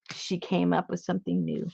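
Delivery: hushed excited whisper. Acted. Host whispering the big news to viewers, hushed. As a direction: whisper it like a thrilling secret.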